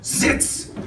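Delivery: curt, hard German command; sharp dog command. Acted.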